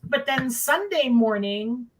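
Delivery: usual speaking voice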